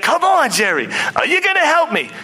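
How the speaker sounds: acted high-pitched pleading; excited client. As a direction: egg on your agent, voice rising high. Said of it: "he's, like, dancing, listening to this music".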